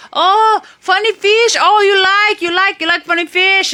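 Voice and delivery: high, loud voice of a delighted local man; excited local. Retold or imitated. Local man showing off his fish to a tourist, excited. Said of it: "the local's, like, throwing fish, and he's like".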